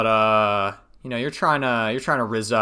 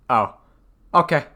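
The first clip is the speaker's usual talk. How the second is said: ordinary talk, nothing marked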